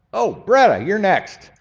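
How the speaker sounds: high, loud playful call. Real, not acted. Preacher picking Bretta to read, loud and high. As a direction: call her name out across the room. Calling on a congregant.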